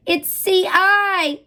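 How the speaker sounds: high, loud, drawn-out announcement with a gasp; excited reveal. Acted. Teacher unveiling a hidden pattern to the class, excitedly. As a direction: reveal it slowly like a big surprise.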